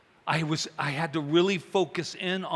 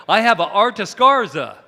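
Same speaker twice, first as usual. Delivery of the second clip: loud and high, declaring it to the room; loud proud announcement; 5 semitones higher, 12 dB louder than usual. Retold or imitated. To the art class, a loud proud declaration. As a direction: blurt it out proudly.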